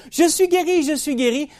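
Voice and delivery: high, loud cries of joy; joyful shouting. Retold or imitated. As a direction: shout it out, healed and overjoyed. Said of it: "elle se met à crier".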